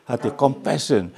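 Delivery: usual speaking voice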